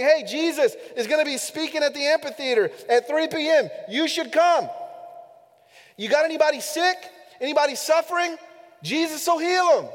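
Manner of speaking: high, loud acted-out rallying call; excited invitation. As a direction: call the crowd in like a disciple spreading news. Acting out disciples spreading the news.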